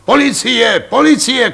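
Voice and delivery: shrill repeated alarm cry; screaming for police. Acted. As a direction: shriek for the police in panic. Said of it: "křičel řemenář".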